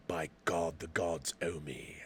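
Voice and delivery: low, slow, hushed classical British hero; Hercules, old-sounding. Acted. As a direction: intone it softly and slowly, like an old British thespian. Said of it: "that's more old".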